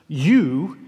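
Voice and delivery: "you" set apart and stressed; emphatic YOU. Shown as a demonstration. Preacher pointing out the Greek word, emphatic YOU. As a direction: land firmly on a lone "you". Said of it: "is in the emphatic position".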